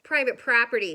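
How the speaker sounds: loud grumpy yell. Acted. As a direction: bark it at the intruder. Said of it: "yelled Hank".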